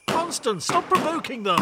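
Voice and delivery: high-pitched, alarmed rebuke; panicked scolding. Acted. Passenger under fire scolding the cab driver, panicked.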